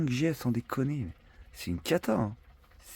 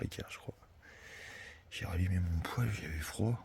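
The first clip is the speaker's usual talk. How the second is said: whispering; 3 semitones lower, 8 dB quieter than usual. Real, not acted. Whispering at night.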